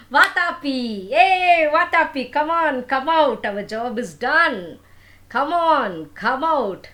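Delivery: loud, high calling to someone inside. Acted. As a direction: shout for the brother to burst out. Demon calls brother out of stomach.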